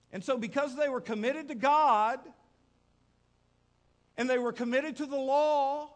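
full-force preaching. Acted. Preacher thundering to the congregation about the priest and Levite, loud. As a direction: preach it with mounting fire.

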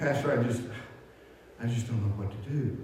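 despairing deacon. Retold or imitated. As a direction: let the voice sink, out of answers. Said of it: "he was all distraught".

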